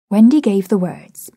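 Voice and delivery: usual speaking voice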